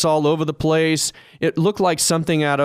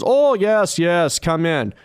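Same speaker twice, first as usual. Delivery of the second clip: higher, swooping mock-Russian welcome; attempted Russian accent; 3 semitones higher, slower than usual. Homeowner inviting the agent in, in a put-on Russian accent. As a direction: do your best amateur Russian accent. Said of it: "that's the best accent that I can do".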